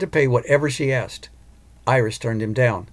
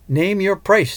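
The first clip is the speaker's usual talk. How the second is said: commanding; 8 semitones higher, 4 dB louder, slower than usual. A narrator's reading. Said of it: "she commanded".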